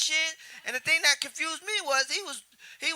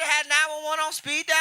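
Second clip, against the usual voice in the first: ordinary delivery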